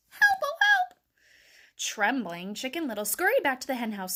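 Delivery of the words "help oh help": very high, loud squeal; squeaked cry for help. A narrator's reading. Chick struck by a falling acorn, squealing for help. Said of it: "he squeaked".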